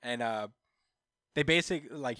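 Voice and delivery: ordinary delivery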